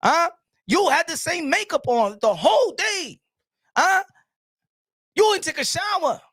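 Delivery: usual speaking voice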